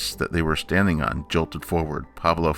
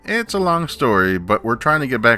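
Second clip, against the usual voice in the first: higher, louder, eager voice; eager greeting; 7 semitones higher, 5 dB louder than usual. A narrator's reading. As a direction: greet them brightly. Snowfluff greets narwhals.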